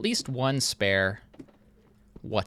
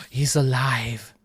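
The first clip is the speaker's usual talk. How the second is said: louder, slow, strange "He's alive"; hammed-up mad scientist; mad scientist cry; 7 dB louder, slower than usual. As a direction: proclaim it like Frankenstein. Bee comes alive.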